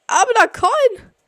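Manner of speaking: high, slow drawn-out call; thrilled yell; excited shout. Real, not acted. Spots a coin mid-game.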